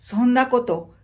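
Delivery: ordinary delivery, nothing marked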